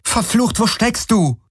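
hoch gerufene, ungeduldige Frage; gereiztes Rufen. A narrator's reading. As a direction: den Vermissten fluchend herbeirufen. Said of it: "rief sie". (hohes, verärgertes Rufen)

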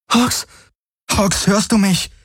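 very high, urgent call; alarmed calling. A narrator's reading. Kirai rushes to fallen Hawks, calling out in alarm. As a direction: call his name in high-pitched alarm. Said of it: "Kirai rief Hawks Namen".

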